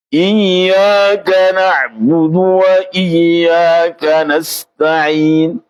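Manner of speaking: Iyyaka na'budu wa iyyaka nasta'in drawn out slowly; slow recitation. Shown as a demonstration. Teacher reciting to the class, slow and careful. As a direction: chant each syllable slowly.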